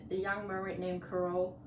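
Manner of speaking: plain reading voice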